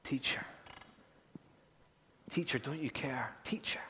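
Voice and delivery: hushed, breathy plea with no voice; urgent whisper. Acted. Disciples whispering to wake the sleeping teacher, urgent whisper.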